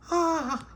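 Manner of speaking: high squealed ah of delight; a little shriek of joy at the ring; delighted gasp. Shown as a demonstration. Pauline squeals with delight at the ring, high and bright. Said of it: "like that".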